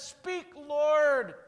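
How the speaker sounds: high and loud declaration; bold answer. Retold or imitated. Samuel finally answering God, bold and loud. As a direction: answer out loud, ready and eager. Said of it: "this time Samuel says".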